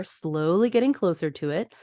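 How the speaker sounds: ordinary delivery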